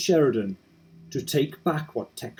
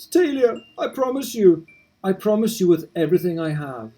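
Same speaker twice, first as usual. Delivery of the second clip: high, louder vow of a grieving husband; fervent promise; 6 semitones higher, 3 dB louder than usual. Acted. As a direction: swear it to her with everything. Kenneth's tearful promise.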